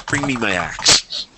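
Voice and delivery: measured command to a subordinate, each word weighted; stern commander. Acted. Commander to his archer, wanting the axe: slow stern order.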